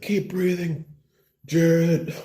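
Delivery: high, strained command; hammed-up rescue plea; mock-desperate urging. Bro carries wounded friend downhill, urging him desperately.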